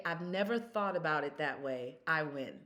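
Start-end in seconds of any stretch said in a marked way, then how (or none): none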